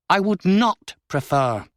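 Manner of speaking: a countess refusing an offer, brittle. A narrator's reading. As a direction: clip each word with cold control. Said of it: "Signier's voice was brittle".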